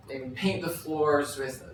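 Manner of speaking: usual speaking voice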